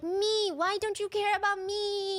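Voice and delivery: put-on high, slow, whining like a big baby; mock him as a bratty toddler; whiny child. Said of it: "it's very like".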